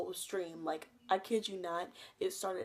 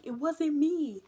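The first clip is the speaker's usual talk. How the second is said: higher, louder defensive denial; defensive guy; 8 semitones higher, 8 dB louder, slower than usual. Retold or imitated. Guy denying to the dad, high loud blurt. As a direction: blurt it like a guilty teen. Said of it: "And he was like".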